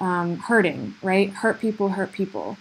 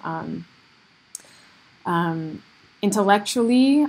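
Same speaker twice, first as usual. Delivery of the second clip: ordinary talk, nothing marked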